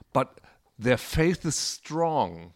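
getragen und gedehnt; sehr langsam, feierlich. Retold or imitated. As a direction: langsam mit falschem Pathos. Zitiert gläubige Christen. (feierlich gedehnt)

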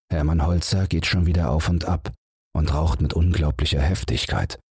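usual speaking voice

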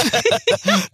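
high, falling burst of laughter; real laughter. Real, not acted. Guest telling urinal story to host, cracks up laughing.

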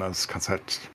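ordinary talk, nothing marked

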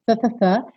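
repeated th with tongue forward; th sound. Shown as a demonstration. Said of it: "Botou a linguinha lá na frente".

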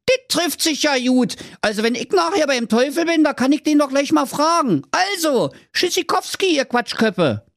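high, cheeky Berlin voice; cocky Berlin hero. Acted. Schnitzelschorsch accepts the king's challenge, high cheeky Berlin voice. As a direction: toss off the challenge with swagger.

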